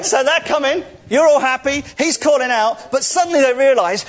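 normal talking voice

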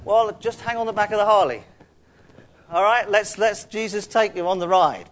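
ordinary delivery

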